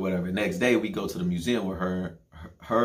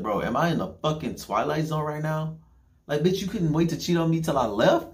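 outraged disbelief; 8 semitones higher than usual. Retold or imitated. Furious at cheating girlfriend.

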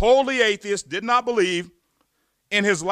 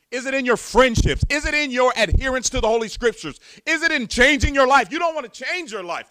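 louder, higher rising preacher's challenge; full-force preaching; 5 semitones higher, 5 dB louder than usual. Acted. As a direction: hammer each question at the congregation.

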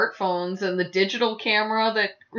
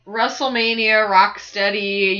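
ordinary delivery

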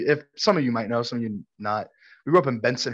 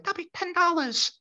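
soft, very high falsetto; name the price as a squeaky cartoon lady; imitated street vendor; 6 dB quieter than usual. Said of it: "she's like".

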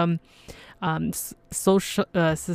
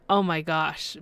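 ordinary talk

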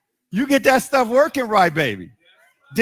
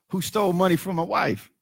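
usual speaking voice

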